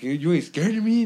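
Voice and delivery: mock tough kid. Younger kid trying to scare him, mock tough. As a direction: ham up the little tough guy.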